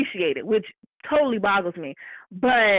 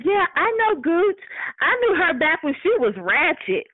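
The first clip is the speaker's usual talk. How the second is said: snooty coworker; 8 semitones higher than usual. Retold or imitated. Coworker bragging she knew her when. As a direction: sneer it out like she owns the room. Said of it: "And she's like".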